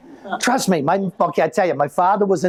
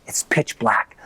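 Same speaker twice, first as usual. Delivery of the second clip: hushed; 7 semitones lower, slower than usual. Acted. Dark street, awed hush.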